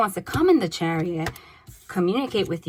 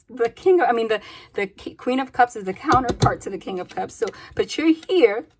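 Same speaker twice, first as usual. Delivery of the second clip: usual speaking voice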